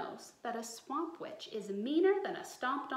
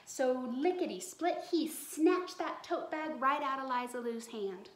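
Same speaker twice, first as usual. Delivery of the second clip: plain reading voice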